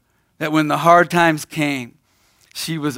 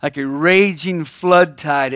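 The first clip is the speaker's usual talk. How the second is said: ordinary delivery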